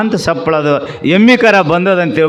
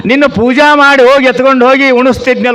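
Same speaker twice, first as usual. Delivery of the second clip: high, loud sermon scolding; preaching at full force; 8 semitones higher, 5 dB louder than usual. Acted. Preacher scolding the father-in-law over his pride, in full sermon voice. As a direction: thunder the moral at the proud man.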